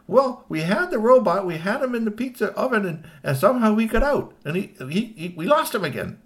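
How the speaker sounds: high, stammering excuse; anxious nephew owning up; flustered explaining. Acted. Nephew explains the loss to uncle.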